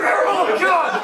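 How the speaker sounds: high, jolted interjection; real shocked outburst. Real, not acted. Comic jolted while quoting dad, crying out high. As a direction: break off with a startled cry.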